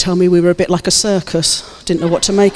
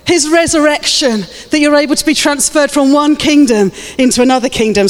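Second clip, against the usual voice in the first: high-pitched, fervent proclamation; rousing sermon delivery; impassioned preaching; 8 semitones higher than usual. Real, not acted. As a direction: preach it to the whole room, lifted high. Preaches at full force.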